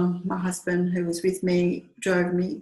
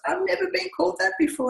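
much higher, fast, affronted; mock indignant; 11 semitones higher, faster than usual. [name] offended at being called a name, high indignant. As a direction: squeal it out as if truly shocked.

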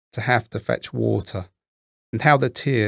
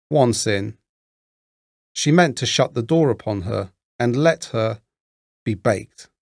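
ordinary delivery

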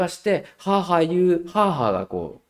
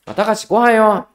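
raised, higher call across the room; louder call to dinner; 10 semitones higher, 11 dB louder than usual. Retold or imitated. Mother calling Takashi again, raised voice. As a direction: call him a second time, louder.

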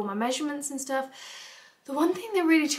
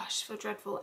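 ordinary talk, nothing marked

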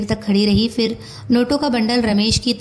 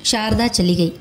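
usual speaking voice

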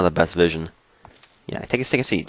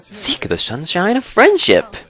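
mock cheerful reading; 9 semitones higher, slower than usual. Reads propaganda poster mockingly.

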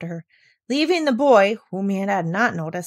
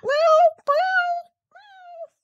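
very high thin mew; a tiny kitten mewing; kitten meow; 4 dB louder, much slower than usual. Acted. Kitten mewing from the girl's pocket, very high.

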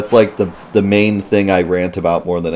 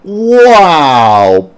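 excited exclaim; 8 semitones higher, 8 dB louder than usual. Pretend. Voicing character in British accent.

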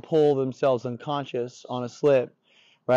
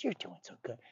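very soft praise to a dog; positive inflection; 13 dB quieter than usual. Shown as a demonstration. Trainer paying the dog in treats, soft positive tone. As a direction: drop right down into sweet praise. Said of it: "Positive tones and inflections".

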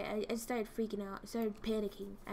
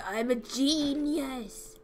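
smug boast; 4 semitones higher, 4 dB louder, slower than usual. Acted. Gamer gloating over own trick.

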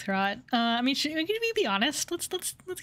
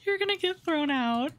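normal talking voice

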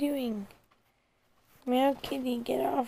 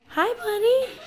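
pet talk; 11 semitones higher, 4 dB louder, slower than usual. Real, not acted. Greeting the dog in a high cooing pet voice.

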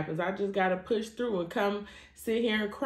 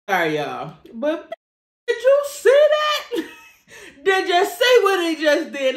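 high, loud, laughing excitement over the game; giddy shrieking football mom; pumped up mom; 11 semitones higher, 10 dB louder than usual. Retold or imitated. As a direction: squeal like a proud mom watching her son score. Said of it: "she would call me so pumped".

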